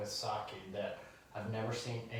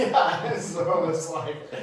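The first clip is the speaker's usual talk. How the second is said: laughing through the words; 6 semitones higher, 11 dB louder, slower than usual. Real, not acted. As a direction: laugh it out as you start the line. Laughing at sake joke.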